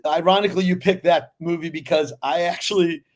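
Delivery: ordinary talk, nothing marked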